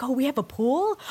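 amazed question. Retold or imitated. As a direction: react amazed to news of a pool. Acts out girl's amazement at pool.

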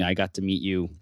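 normal talking voice

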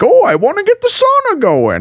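very high, hammed-up holler; imitating a loud goofy woodsman; loud Finnish mountain man. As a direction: loudly announce it to yourself in a goofy character voice. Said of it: "he sounds like that".

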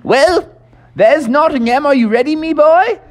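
put-on high, hearty old English toad; jolly Mister Toad. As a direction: boast like a merry old gentleman toad. Mister Toad voiced in story.

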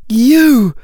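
high, loud cry of astonishment; astonished. A narrator's reading. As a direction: blurt it out high in sheer surprise. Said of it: "Daphne said in astonishment".

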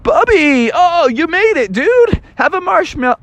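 high, excited greeting; excited friends. Acted. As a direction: greet him high and cheerful.

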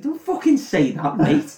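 high-pitched exasperated protest; mock outraged Frodo. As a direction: squeal it in mock disbelief. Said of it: "And it's like".

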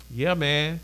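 usual speaking voice

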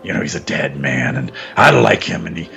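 low, rambling impression, mumbled in fits and starts; Vince. Co-host acts out the boss rambling, low mumbling impression. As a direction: drop the pitch and ramble on haltingly. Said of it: "Vince was saying stuff like".

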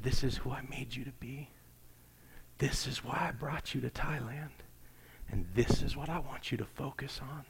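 softened voice, imitating a whisper; retold whisper. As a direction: quote it gently, softly, with spaced phrases. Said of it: "what Jesus whispered to him".

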